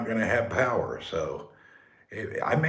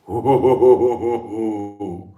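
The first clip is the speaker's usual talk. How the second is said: mock sinister villain laugh; spooky laugh; 8 dB louder than usual. Playing shadow games in the dark, hammed-up spooky laugh. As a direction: cackle like a radio phantom.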